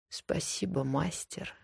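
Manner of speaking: soft whisper with ragged breath; breathless whisper. Acted. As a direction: whisper it between broken breaths. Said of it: "Её сбивчивый шёпот и прерывистое дыхание".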